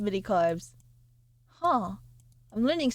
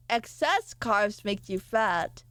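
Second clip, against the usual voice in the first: normal talking voice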